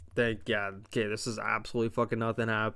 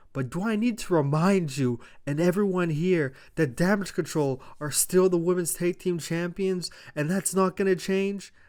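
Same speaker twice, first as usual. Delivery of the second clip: higher-pitched imitation of indignation; mock angry; 5 semitones higher, 4 dB louder than usual. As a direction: take offence, only half acting the anger. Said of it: "saying this in an angry voice".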